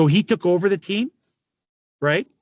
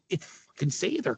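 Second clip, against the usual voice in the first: muttered dread; 8 dB quieter than usual. Retold or imitated. Realizing who is calling.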